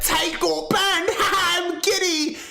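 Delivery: high, drawn-out giddy mimicry; mock gleeful gloating. Streamer mimicking a grown man celebrating someone's ban, mock giddy.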